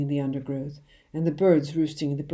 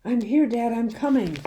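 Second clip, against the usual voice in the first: high, loud answer; calling out; 7 semitones higher, 4 dB louder than usual. A narrator's reading. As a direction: shout back while running toward him. Boy calling back while running.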